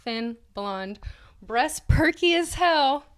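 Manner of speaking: ordinary talk, nothing marked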